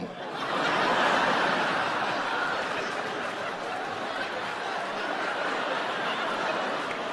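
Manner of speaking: high, soft, breathless laughing fit; long incredulous laugh. Real, not acted. Comic laughing at the elf costume breakdown. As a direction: laugh in disbelief.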